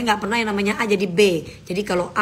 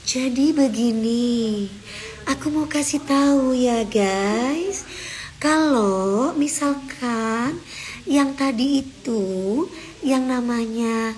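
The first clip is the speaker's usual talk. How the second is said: very slow, drawn-out sweet talk, higher than usual; dibuat-buat lembut; 3 semitones higher, much slower than usual. Shown as a demonstration. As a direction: stretch every phrase out in a mock-gentle, put-on way. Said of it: "itu bukan gue ya". (pura-pura lembut, pelan mendayu-dayu)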